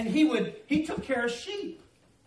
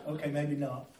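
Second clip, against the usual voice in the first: laughing aside; 9 semitones lower, 8 dB quieter than usual. Real, not acted. Self-mocking joke.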